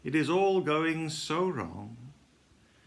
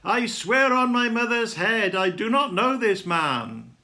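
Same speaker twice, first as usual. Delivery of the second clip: loud, high shouted oath; angry shout; 8 semitones higher, 7 dB louder than usual. A narrator's reading. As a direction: shout the denial as a furious oath. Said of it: "I curse my accuser and shout".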